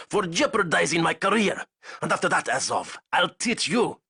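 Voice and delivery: high, menacing threat; angry threat. Acted. Zayson threatening Azov, high menacing voice. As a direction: promise revenge through the teeth. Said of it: "Zayson said".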